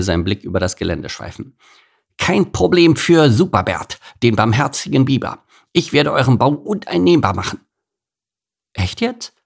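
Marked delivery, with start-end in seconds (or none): 2.2-7.6 higher, louder self-proclaimed hero; boastful beaver hero. Acted. Beaver promises the ants help, boastful. 8.7-9.3 high, baffled little voice; baffled ants. Acted. Ants question the beaver's promise, baffled. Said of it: "fragten die Ameisen verblüfft".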